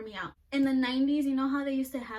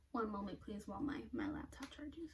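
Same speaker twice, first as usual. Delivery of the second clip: whispering; 9 dB quieter than usual. Real, not acted. Waiting while the laptop charges.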